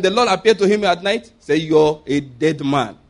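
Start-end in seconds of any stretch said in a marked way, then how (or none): 1.6-3.0 stern God voice. Acted. God's verdict.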